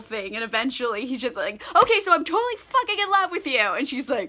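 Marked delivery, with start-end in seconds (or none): 1.7-3.6 blurted confession. Retold or imitated. Knightley blurts out love confession.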